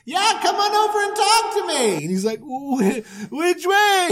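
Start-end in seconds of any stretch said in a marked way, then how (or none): 0.0-1.9 high, breezy invitation; God inviting Abram to chat; casual God. Retold or imitated. God beckoning. 2.4-4.1 high, halting question; bewildered old man. Retold or imitated. Abram lost.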